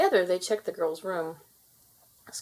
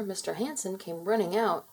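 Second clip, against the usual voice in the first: ordinary delivery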